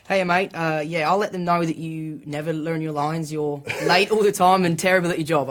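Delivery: a light, bad Australian accent, cheeky; lightly put-on Australian accent, teasing mate; mock Australian accent with a grin. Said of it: "Sorry for my Australian accent".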